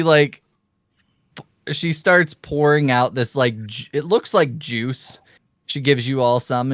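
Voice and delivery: usual speaking voice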